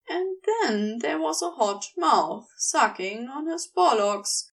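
ordinary delivery, nothing marked

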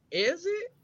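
"is it" rising high at the end; "is it" lifted into a question mark; questioning tune. Shown as a demonstration. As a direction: turn "is it" into an unsure, soft question. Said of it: "Not with the question mark behind it".

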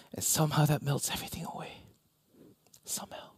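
barely-there whisper. Real, not acted. Pastor marveling at grace, whispered. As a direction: breathe the word out in wonder.

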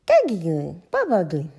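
put-on loud toddler babble; George shouting. A narrator's reading. George greeting his grandparents, loud baby talk. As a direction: shout garbled names like a tiny child. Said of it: "shouted George".

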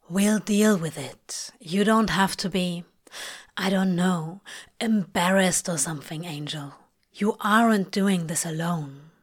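understated, low reassurance; low-voiced, reassuring Crowley. Crowley telling Aziraphale he isn't alone, low and reassuring. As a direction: keep it low and matter-of-fact, consoling a friend.

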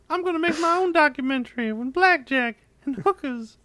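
squeaky indignant impression; mock Josh voice. Acting out Josh quitting.

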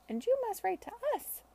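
put-on high child's voice, near tears; a young girl pleading, on the verge of crying; tearful little girl. Alicia begging Charlotte to write back, tearful child voice. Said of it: "who was close to tears".

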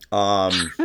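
usual speaking voice

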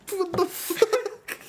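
high, slow, laughing what the fuck; laughing disbelief. Real, not acted. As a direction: stretch it out through real laughter. Laughing at the vomit story.

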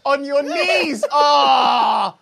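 high, drawn-out laughing shout; shocked laugh. Real, not acted. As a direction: yelp in disbelief.

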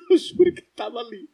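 laughing through the words; giggling while swearing it's true. Retold or imitated. Telling friends the boy really was there, laughing.

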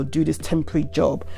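normal talking voice

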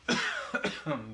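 throat-clearing cough; real cough. Real, not acted. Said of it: "Excuse me one second again".

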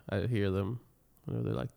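usual speaking voice

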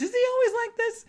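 very high, loud mock-incredulous question; imitated amazement. Salesman asking Roger about the joker, high mock amazement. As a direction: play an astonished salesman, voice way up.

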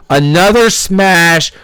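normal talking voice

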